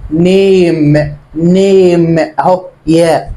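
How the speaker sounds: نيم drawn out very slowly, a said like ay; reading name aloud. Shown as a demonstration. Teacher reading نيم slowly for the class. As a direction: stretch نيم slowly with the ay sound. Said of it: "يلا اقرأ بقى".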